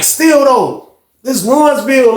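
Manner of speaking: usual speaking voice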